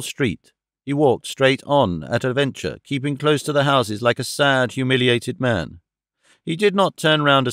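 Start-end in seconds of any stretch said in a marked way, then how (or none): none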